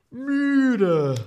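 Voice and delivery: high, loud groaning müde; tired. Shown as a demonstration. Teacher recaps the word for tired. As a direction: moan the word out wearily. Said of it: "was haben wir heute gelernt".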